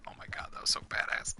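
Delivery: fast, breathless awed whisper. Retold or imitated. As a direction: whisper it like you can't believe what you saw. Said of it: "I was just like".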